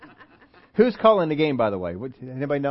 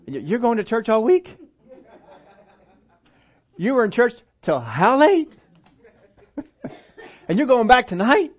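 mock disbelief; 5 semitones higher than usual. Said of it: "when we have special meetings".

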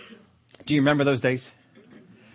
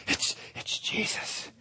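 slow hushed whisper; awed whisper; 12 dB quieter, much slower than usual. Acted. Preacher whispers Bartimaeus's awe at Jesus. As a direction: say it in stunned wonder.

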